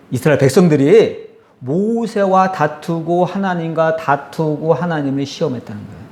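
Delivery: usual speaking voice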